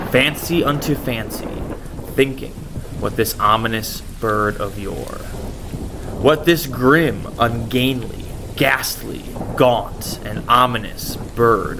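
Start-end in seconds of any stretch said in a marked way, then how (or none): none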